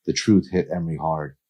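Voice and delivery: ordinary delivery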